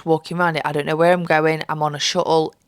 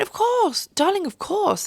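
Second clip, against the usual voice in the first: gushing stewardess; 13 semitones higher than usual. Retold or imitated. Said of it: "they went".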